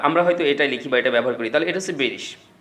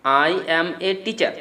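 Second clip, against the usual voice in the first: slow, each word of 'I am a teacher' set apart; clear word-by-word; 3 semitones higher, much slower than usual. Shown as a demonstration. Teacher making it clear for learners, slow word-by-word delivery. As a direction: spell it out word by word, very slowly. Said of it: "এরকম বললে বোধহয় ব্যাপারটা clear লাগতো".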